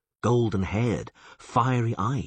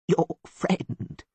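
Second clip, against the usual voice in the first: high, hushed goat-like voice; quiet bleating; 10 semitones higher than usual. A narrator's reading. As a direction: bleat it confidentially. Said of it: "he bleated quietly".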